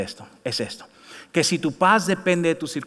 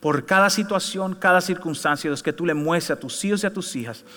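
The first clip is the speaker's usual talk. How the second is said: prayer; 4 semitones lower than usual. Acted. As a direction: pray gently, lowering to a hush. Leads congregation prayer.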